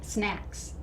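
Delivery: ordinary delivery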